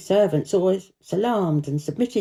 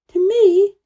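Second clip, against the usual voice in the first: put-on much higher and louder, amazed child; delighted disbelief; surprised Mary; 12 semitones higher, 8 dB louder than usual. A narrator's reading. Mary hearing the robin likes her, surprised. Mary's voice.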